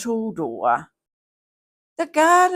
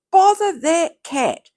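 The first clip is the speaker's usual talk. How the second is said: higher, louder exasperated bother; annoyed mother; 7 semitones higher, 5 dB louder than usual. Acted. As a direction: scold the cat, fed up. Mother scolds the cat again.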